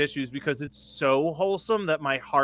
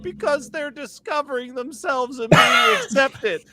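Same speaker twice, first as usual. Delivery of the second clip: tearful gush; 10 semitones higher than usual. Retold or imitated. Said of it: "And I said".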